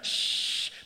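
long, sharp "shh"; shushing. Acted. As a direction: hush someone who is shouting. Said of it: "la gente decía". (chistido para hacer callar)

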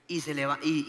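usual speaking voice

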